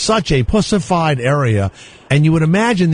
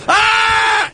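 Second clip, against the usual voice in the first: mock scream. Shown as a demonstration. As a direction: scream like you're really in danger. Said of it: "go like this".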